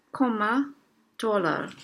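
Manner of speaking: usual speaking voice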